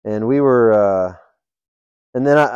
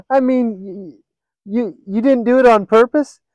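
amused friend; 10 semitones higher, 3 dB louder than usual. Retold or imitated. Allison giggling, asking about the joke.

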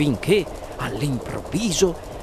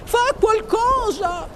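very high, drawn-out demand; wife's shout; slower than usual. A narrator's reading. Wife urging her husband to act, shrieked order. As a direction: falsetto screech of urgency. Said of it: "gridò la signora Gloop a suo marito".

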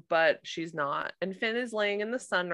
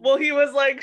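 usual speaking voice

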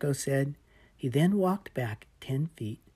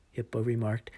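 ordinary delivery, nothing marked

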